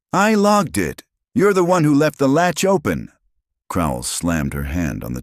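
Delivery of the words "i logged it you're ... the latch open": high, loud angry shouting. A narrator's reading. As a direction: yell it back in a heated quarrel. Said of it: "Two agents shouted across the room".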